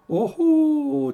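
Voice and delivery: very high, loud, aroused "oh"; a high hammed-up swooning cry; breathless exclamation. A narrator's reading. Concierge cutting Orphée off, high breathless cry. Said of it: "d'une voix entrecoupée".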